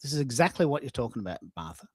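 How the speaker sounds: ordinary talk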